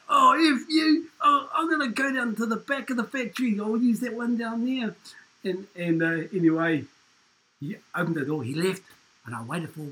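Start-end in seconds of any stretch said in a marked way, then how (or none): 0.1-4.9 whiny coworker. Retold or imitated.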